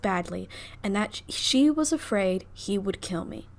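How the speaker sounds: lightly Southern belle reading; a hint of a Southern debutante; Southern debutante accent. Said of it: "in a Southern debutante accent".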